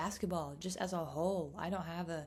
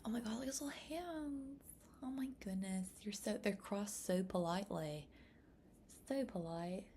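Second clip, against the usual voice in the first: cooing; 3 semitones higher, 6 dB quieter than usual. Real, not acted. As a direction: coo over little hands. Cooing over a baby's hands.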